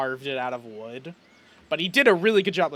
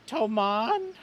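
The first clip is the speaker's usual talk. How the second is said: higher, questioning small voice; timid appeal; 5 semitones higher than usual. Acted. Chet looks up after a long cry, tentatively. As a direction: call the name tentatively, as if after crying.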